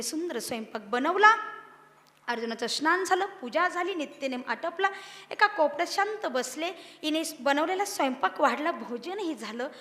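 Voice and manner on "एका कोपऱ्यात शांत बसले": ordinary talk, nothing marked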